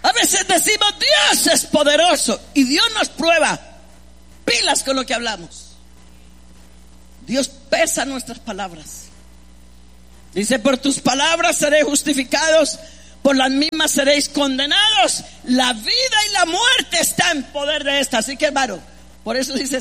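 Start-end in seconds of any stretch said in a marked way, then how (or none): 0.0-3.6 high, raised preacher's warning; ringing sermon voice, stretched for emphasis; exclamatory preaching. Real, not acted. Preaches at full force. 10.6-18.2 raised preacher's voice quoting the Bible; forceful scripture recitation; declaimed scripture. Real, not acted.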